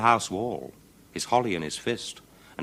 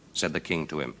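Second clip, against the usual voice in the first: ordinary delivery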